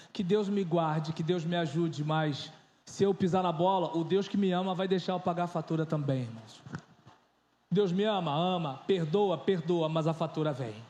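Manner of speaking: a hint of a pulpit warning; preaching. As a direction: warn the brothers gravely, pastor style. Preacher's warning, slow low.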